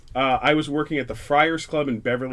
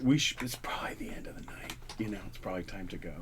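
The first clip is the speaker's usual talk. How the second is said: hushed hint to leave; 4 semitones lower, 12 dB quieter, much faster than usual. Retold or imitated. Host hinting guest should leave.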